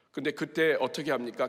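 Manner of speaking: ordinary talk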